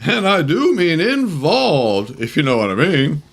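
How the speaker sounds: Don Knotts impression. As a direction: play it twitchy and flustered. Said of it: "play Don Knotts".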